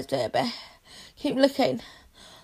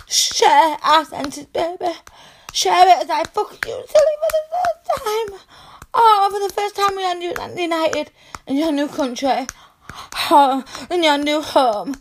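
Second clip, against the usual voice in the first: ecstatic, breathless; 5 semitones higher, 3 dB louder than usual. Acted. Ecstatic climax speech.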